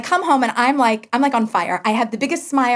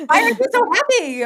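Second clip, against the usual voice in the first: puzzled husband; 12 semitones higher than usual. Retold or imitated. Husband questioning her odd face.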